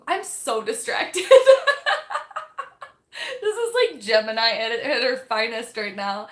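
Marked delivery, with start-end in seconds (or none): 1.3-2.9 loud high burst of laughter; laughing at her own distraction; big laugh. Real, not acted. Vlogger bursting into laughter at herself. Loses train of thought, laughs.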